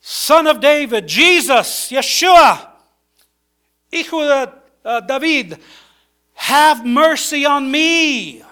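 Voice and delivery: loud, high, insistent plea; crying out. Acted. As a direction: call out each name forcefully, then stretch the plea. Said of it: "He says".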